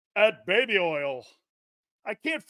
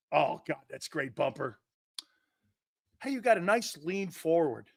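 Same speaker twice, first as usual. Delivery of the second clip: usual speaking voice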